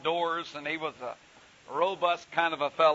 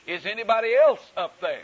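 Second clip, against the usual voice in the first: punchline cry; 6 semitones higher, 9 dB louder than usual. Acted. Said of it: "and then he said".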